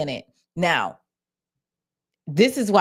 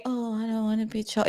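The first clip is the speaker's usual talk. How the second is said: much softer mock gargly voice; changed voice; 3 semitones higher, 7 dB quieter than usual. Host shows how the voice completely changed, gargling. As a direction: imitate her voice gone soft and gargled. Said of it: "It just completely changed".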